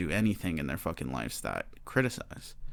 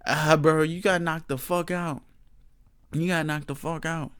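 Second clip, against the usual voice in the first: mocking the fan; 6 semitones higher, 3 dB louder than usual. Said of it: "just being like".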